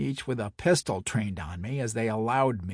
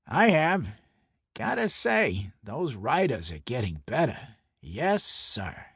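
Brooklyn mobster voice. Acted. Santoni voiced in story.

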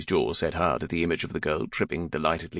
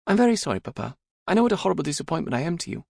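high, hammed-up apology; high-voiced teasing penitence; mock contrite daughter; 11 semitones higher, 3 dB louder, much faster than usual. A narrator's reading. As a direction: apologise to Papa with playful false remorse, pitched up. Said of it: "It was a long-cherished joke".

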